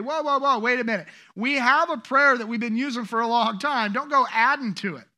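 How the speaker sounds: loud, emphatic pushback; acted objection. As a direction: halt someone with a loud protest.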